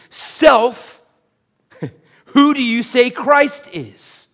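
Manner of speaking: high, loud challenge to oneself; emphatic self-address. Acted. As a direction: call yourself to account, loudly. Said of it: "All of us need to daily remind ourselves".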